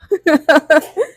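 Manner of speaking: a sudden burst of real laughter, high and loud. Real, not acted. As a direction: break into a laugh at yourself. Laughs at stumbling over "attendant. (biglang tawa sa sarili)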